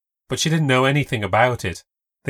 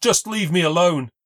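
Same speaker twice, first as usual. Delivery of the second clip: raised voice; 7 semitones higher, 4 dB louder than usual. A narrator's reading. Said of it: "he started to get louder".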